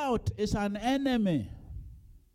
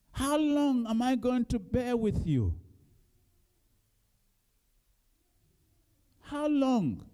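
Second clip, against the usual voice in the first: higher, exasperated and angry; fed up; 6 semitones higher than usual. Shown as a demonstration. Jesus fed up with disciples who cannot heal, angry. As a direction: let the frustration boil over on long. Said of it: "It sounds to me that he's angry".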